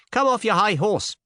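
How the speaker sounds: put-on high, abrupt scolding young lady; sudden rebuke. A narrator's reading. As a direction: cut in with a high-pitched rebuke. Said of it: "said the lady suddenly".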